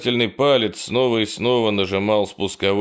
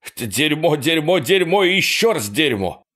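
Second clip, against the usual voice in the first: panicked cursing; 6 semitones higher than usual. A narrator's reading. Panicked inner cursing while fleeing.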